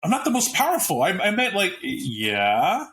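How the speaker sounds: high, flustered backpedaling voice; mock him stammering out a denial; flustered Xavier. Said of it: "it's like Xavier going".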